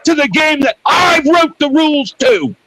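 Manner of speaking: indignant, rising to a shout; angry rant. Real, not acted. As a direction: demand it, furious at their nerve. Ranting at his critics.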